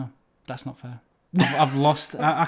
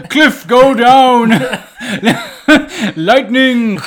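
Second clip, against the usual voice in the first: hammed-up Saruman shouts; 6 semitones higher, 12 dB louder, much slower than usual. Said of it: "when he's on the top".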